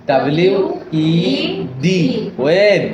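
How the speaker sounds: W, ই, ডি with gaps between, then the syllable ওয়েড; spelling by syllable. Shown as a demonstration. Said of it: "ভাগ ভাগ করে পড়ে নিচ্ছেন".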